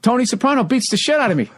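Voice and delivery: high, strained plea to a dead crowd; pushing hard to win them over; desperate comic. Retold or imitated. Aging comic begging a young crowd to like him, strained and high.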